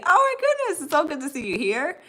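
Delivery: ordinary talk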